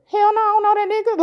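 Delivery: fast, high-pitched denial; sudden high-pitched "hell no" said quickly; retold blurt. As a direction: fire it off high and fast like you're shocked. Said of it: "that's what I said to him".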